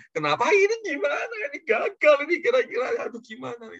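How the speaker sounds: high-pitched, flustered inner voice; retold panic. Preacher voicing his worry as the visit fails, panicked. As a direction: fret aloud in a hammed-up panic.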